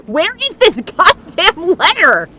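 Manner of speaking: loud, high-pitched frustration; exasperated. Real, not acted. Player hunting for a missing letter, loud exasperation. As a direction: demand it loudly, fed up.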